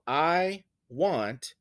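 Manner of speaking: slow "I want", weight on want; iambic stress. Shown as a demonstration. Teacher showing an iamb, slow stress on want. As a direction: stretch out "I want" as unstressed, stressed. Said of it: "is an iamb".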